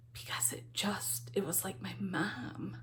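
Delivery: tearful near-whisper, barely voiced; choked-up whisper. Real, not acted. Vlogger remembering her mom, choked-up whisper. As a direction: voice catching on tears, almost soundless. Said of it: "I'm getting choked up".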